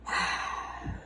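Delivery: long soft unvoiced sigh; heavy sigh. Real, not acted. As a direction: let out a weary breath. Sighing over the cards.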